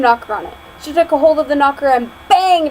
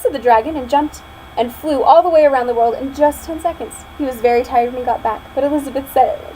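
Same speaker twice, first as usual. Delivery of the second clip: plain reading voice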